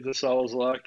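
ordinary delivery

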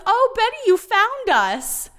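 high, slow breathy-free mock sweet voice; imitating Polly. Podcast host voicing Polly reuniting with her sister, high and slow. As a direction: lift way up and stretch it like a dreamy Polly.

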